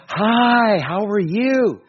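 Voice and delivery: very high, loud, drawn-out perky greeting; over-medicated cheery cashier. Retold or imitated. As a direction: imitate a manically happy cashier. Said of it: "antidepressant stuff is starting to freak me out".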